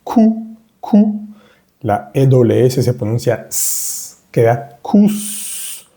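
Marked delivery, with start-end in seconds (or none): 0.0-1.2 cu, higher, rounded ou; French ou sound. Shown as a demonstration. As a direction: say cu with pursed lips. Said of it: "la C con el sonido O U". (cu con labios redondeados, más agudo)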